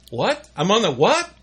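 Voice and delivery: shocked to learn he's broadcasting; startled realisation. Retold or imitated. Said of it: "one of these days I'm gonna".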